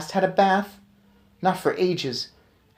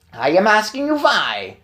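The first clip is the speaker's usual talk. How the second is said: furious witch scream; 7 semitones higher, 4 dB louder than usual. Acted. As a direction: rage at the children on the beach. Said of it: "she screamed".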